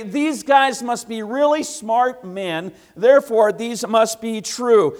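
gullible listener. Retold or imitated. Preacher mimicking a believer swayed by smart men, slow and earnest. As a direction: lay it out like a convinced fan.

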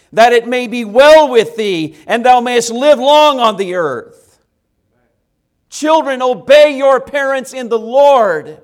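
loud, high, ringing pulpit voice; booming sermon delivery to the children; full-force preaching. Acted. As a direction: preach it out to the whole room.

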